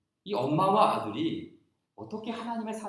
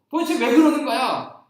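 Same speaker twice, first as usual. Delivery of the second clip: higher, louder anxious question; worried mother; 9 semitones higher, 12 dB louder than usual. Retold or imitated. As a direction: plead with the child to explain. Mother asks crying son.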